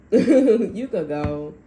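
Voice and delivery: laughing it out, slow and loud. Retold or imitated. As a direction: repeat the line through a laugh, drawn out. Laughing repeat of quote.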